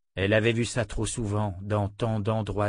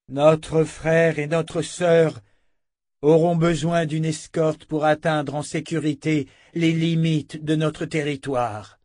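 slow, raised, higher voice; solemn proclamation; 6 semitones higher, 4 dB louder, slower than usual. Acted. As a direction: announce it to the whole assembly, word by word. Chief proclaims to the assembly.

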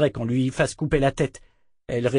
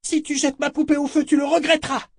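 very high-pitched cry of warning; desperate servant girl standing up; defiant cry; 4 dB louder than usual. A narrator's reading. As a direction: cry out the threat in a high child's voice. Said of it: "cria-t-elle".

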